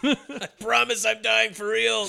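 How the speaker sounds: slow, high plea through laughter; laughing mock plea. Dying man insisting over the radio, laughing high plea. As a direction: giggle while begging.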